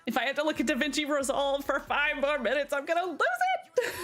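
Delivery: comic overwhelmed whine, rising in pitch; mock exasperation. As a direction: act out being at the end of your rope. Acts out fed-up burnout reaction.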